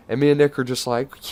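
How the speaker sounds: normal talking voice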